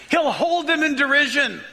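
usual speaking voice

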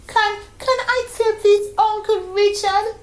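put-on high, fast, stammering child bursting with excitement; a small girl who can't contain her joy; excited little girl. A narrator's reading. Donome to her uncle, thrilled to hear Mommy on radio, excited child voice.

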